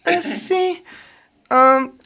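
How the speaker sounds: put-on high falsetto girlfriend voice; woman voice. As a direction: ask sweetly in a squeaky girl voice. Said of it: "Ah, así más o menos se, se hablaba". (voz de novia en falsete agudo)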